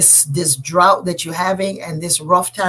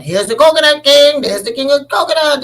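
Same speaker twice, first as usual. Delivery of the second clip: loud, higher mocking chant; jeering taunt; 7 semitones higher, 7 dB louder than usual. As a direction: call it out to humiliate him. People tease Elegba everywhere.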